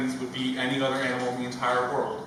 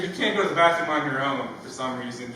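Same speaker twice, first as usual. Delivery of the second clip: ordinary talk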